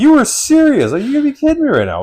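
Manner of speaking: high, loud, fast indignant rant; retelling his fury at the salesman, high and loud; outraged disbelief. Retold or imitated. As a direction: let the outrage spill out high.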